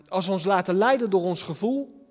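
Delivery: normal talking voice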